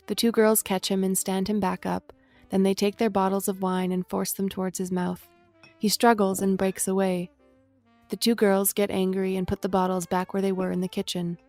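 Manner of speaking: ordinary delivery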